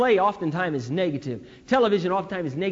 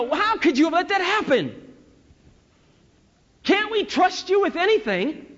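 high, exasperated mock scolding; scolding parent; 12 semitones higher, 4 dB louder than usual. Father to daughter after baby's fall, exasperated high scolding. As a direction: pitch it up like a parent blaming a child.

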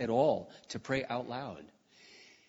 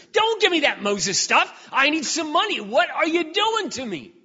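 desperate wail; 9 dB louder than usual. Retold or imitated. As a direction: wail at God, near breaking. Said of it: "tears are streaming down my face".

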